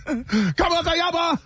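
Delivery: a laughing movie impression; movie quote. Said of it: "how my man said it in forty-year-old virgin".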